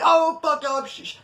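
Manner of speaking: high-pitched burst dropping into shushes; outburst then hush. Retold or imitated. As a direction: blurt it out, then hush yourself. Laughing too loud, then hushing.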